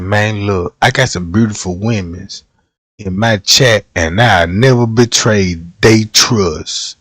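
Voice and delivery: low, soft, smooth lover-man voice; smooth ladies' man. Pretend. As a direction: purr it low to charm the ladies in chat. Streamer playing smooth man to chat.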